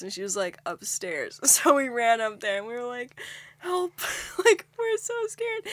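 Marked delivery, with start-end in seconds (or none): 3.6-5.6 high, squeaky cry for help; mock scared plea. Running upstairs to his mom, mock-frightened high plea. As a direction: imitate a frightened plea in a much higher voice.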